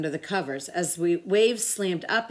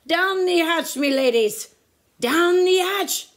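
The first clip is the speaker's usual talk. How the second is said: high, loud pirate toast, last slow; pirate yell; 10 semitones higher, 6 dB louder than usual. A narrator's reading. Captain and crew toast at dinner: loud yell. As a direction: bellow it across the table. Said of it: "pounded his fist on the table and yelled".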